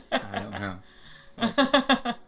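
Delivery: slow words through laughter; drawn out while laughing. Real, not acted. Laughs at a teasing question.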